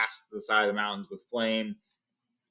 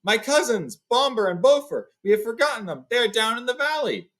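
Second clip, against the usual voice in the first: high, raised alarmed voice; anxious cry; 11 semitones higher, 4 dB louder than usual. Acted. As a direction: cry out for the lost cousins. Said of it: "Bifur gave a cry".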